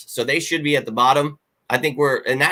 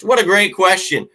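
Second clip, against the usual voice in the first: mock enthusiasm; 7 semitones higher, 3 dB louder than usual. Mock reply to fan question.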